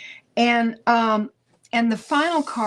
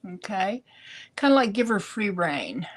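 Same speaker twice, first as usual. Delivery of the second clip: ordinary talk, nothing marked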